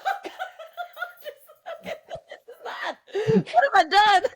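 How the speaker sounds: high, loud mock horror, half laughing; hammed-up regret at your own creation; hearing the nickname yelled, loud comic dismay. Her reaction to being recognized.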